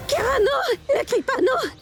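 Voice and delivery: high, urgent pleading; panicked plea. Acted. Mother begging her daughter not to cry out, panicked. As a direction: beg in alarm, voice pitched high.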